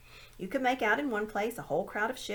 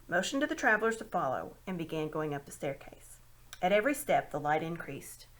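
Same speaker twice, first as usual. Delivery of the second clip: ordinary delivery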